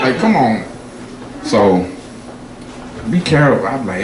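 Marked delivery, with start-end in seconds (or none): none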